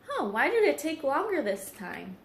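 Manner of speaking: ordinary delivery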